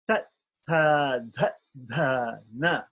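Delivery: ta, ta, ta, na with the tongue tip on the teeth; dental syllables. Shown as a demonstration. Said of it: "the tongue is touching the tip of the teeth".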